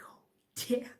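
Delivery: hushed, unvoiced dismay; a caught kid's whispered curse; whispered oh damn. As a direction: breathe it out in a panic under your breath. Sees dad's car pull up.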